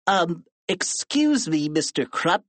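put-on tattletale kid voice; Melvin Sneedly. A narrator's reading. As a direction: interrupt the principal politely and primly. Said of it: "said Melvin Sneedly".

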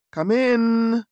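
high, loud call through the door. A narrator's reading. Posed and calling Lucy in, high and loud. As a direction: call him in with a flourish. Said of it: "I called".

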